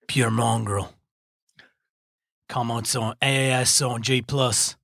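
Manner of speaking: hammed-up fake Australian accent reading an email; bad Australian accent. Reading a listener email aloud in a bad Australian accent. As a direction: put on a clumsy Australian twang. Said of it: "Do a bad Australian accent".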